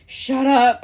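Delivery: loud mock snap of shut up; sleepy snap. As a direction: bark it like a half-asleep boyfriend. Boyfriend snapping in his sleep.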